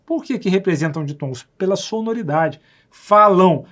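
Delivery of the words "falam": falam said loud as falão, nasal ão; spoken as written falão. Shown as a demonstration. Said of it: "Dá a impressão que tá escrito assim".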